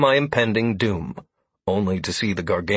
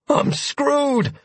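dread. A narrator's reading. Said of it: "I realized with a sickening sensation".

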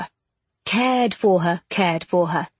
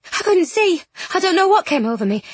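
higher, flustered excuse; embarrassed apology; 8 semitones higher than usual. A narrator's reading. Bronte excusing the sudden kiss, embarrassed. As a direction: hurry out a mortified explanation. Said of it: "giving an embarrassed cry".

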